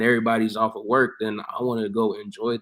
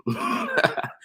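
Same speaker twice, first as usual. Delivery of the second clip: high squealing laugh. Real, not acted. Laughing at his own story.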